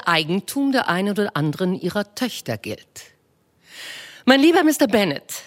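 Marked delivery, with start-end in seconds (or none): 4.2-5.3 Mrs Bennet voiced high and bright-eyed, fussing; a high, eager matron gushing to her husband; eager wife. Wife to her husband with news, eager high voice. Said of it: "sagte seine Gemahlin".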